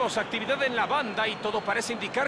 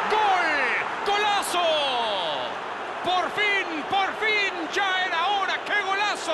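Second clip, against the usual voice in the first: breakneck goal commentary; 7 semitones higher, slower than usual. Real, not acted. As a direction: scream the goal with joy. Goal call shout.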